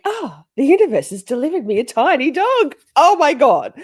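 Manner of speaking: loud, high, overjoyed gushing; acted-out ecstatic outburst; retold elation. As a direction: gush as if handed a miracle. Retold joy at receiving a dog.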